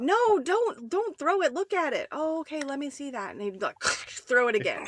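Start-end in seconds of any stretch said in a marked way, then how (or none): 0.0-2.1 high, mock-urgent little-kid plea; pleading child. Little daughter to dad who throws toys, pleading. 2.1-3.3 dad feigning interest. Retold or imitated.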